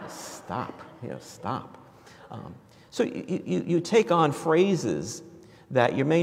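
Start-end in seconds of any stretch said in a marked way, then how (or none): 0.1-1.8 breathless hushed stop; whispered stop. Retold or imitated. College friends hushing a talkative classmate, whispered. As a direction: breathe the word stop like a shared joke. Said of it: "we'd look at each other and go".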